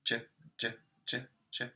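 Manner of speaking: J, J whispered as a phonics sound; quiet letter sound demo; soft phonics sound. Shown as a demonstration. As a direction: breathe J, J softly like a phonics drill. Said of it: "What sound does J make?".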